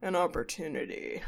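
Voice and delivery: mock-ominous, low and soft; hushed imitation of a foreboding TV host. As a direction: drop it low with a dramatic pause. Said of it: "he ominously looks at the camera and goes".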